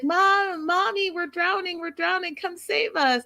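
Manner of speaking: high-pitched mock plea to Mom; high, whiny fake distress call; joking cries of drowning. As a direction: play daughters teasing that they need rescue. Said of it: "they start joking that they're drowning".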